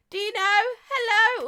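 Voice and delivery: high, loud, drawn-out hello; calling out. Real, not acted. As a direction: hail a viewer with a big slow call. Greeting viewers as they join.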